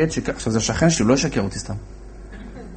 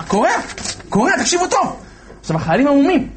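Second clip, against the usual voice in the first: excited; 12 semitones higher, faster than usual. Retold or imitated. Commander tears the sheet.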